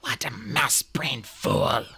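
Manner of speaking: slow, whispered hiss; drawn-out, voiceless contempt; bitter self-reproach. A narrator's reading. Leader berates herself, eyes closed.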